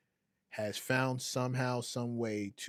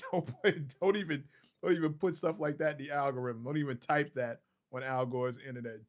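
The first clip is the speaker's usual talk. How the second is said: laughing scold; 3 semitones higher than usual. Real, not acted. Begging the chat to stop, still laughing.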